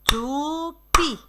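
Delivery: "toupie" as two long, separate syllables, tou, pie; scanned syllables. Shown as a demonstration. Teacher demonstrating "toupie" for children to scan, syllable by syllable. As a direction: draw out each syllable of "toupie". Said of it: "Scandez toupie".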